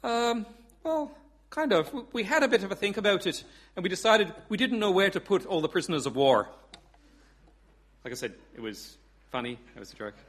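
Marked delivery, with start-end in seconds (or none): 0.0-6.5 hesitant, higher Irish caller; Irish accent. Acted. Paddy calling off the invasion, hesitant Irish accent. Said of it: "I do do an Irish accent".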